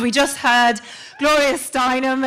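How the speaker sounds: usual speaking voice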